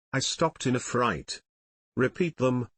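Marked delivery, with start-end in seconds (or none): none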